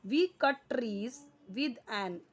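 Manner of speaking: very slow, word by word for the class; dictating to students; slow dictation. Shown as a demonstration. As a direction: spell the sentence out slowly like a teacher. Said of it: "cut यानी काटते हैं".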